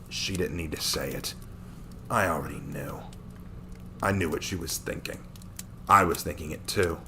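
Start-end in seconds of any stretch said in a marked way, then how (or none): none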